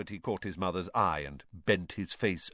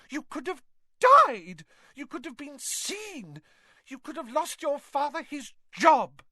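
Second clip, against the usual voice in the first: high, loud mother's scolding; furious shouting; 6 dB louder than usual. A narrator's reading. Mother shouting at her sons until hoarse, loud and high. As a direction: hammer each "could have" at them. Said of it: "shouted herself hoarse".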